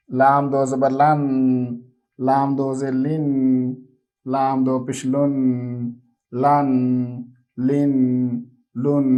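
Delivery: put-on steady sing-song letter drill, said slowly for children; measured recitation for a child to repeat after. Shown as a demonstration. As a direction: chant each syllable like a classroom takhti reading. Teacher chants the alphabet drill.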